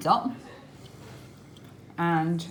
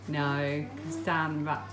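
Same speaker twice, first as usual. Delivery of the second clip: ordinary talk, nothing marked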